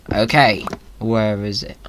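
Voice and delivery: usual speaking voice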